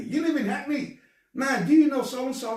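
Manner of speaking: high, loud imitation of a nosy acquaintance; mock eager questioner. Host imitates people asking about Hackney, high mock-excited voice. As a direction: pitch it right up, mimicking silly questions. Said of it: "they all want to tell me about someone they know".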